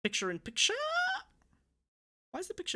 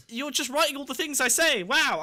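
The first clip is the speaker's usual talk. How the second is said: higher, louder indignant complaint; indignant outburst; 9 semitones higher, 7 dB louder than usual. Real, not acted. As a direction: call out the donor in disbelief. Complains donor copies his words.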